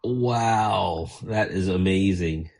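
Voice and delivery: usual speaking voice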